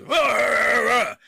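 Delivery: loud, high, wordless rant; intense angry outburst. Acted. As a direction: roar out a garbled angry tirade. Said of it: "match the intensity of the speaker".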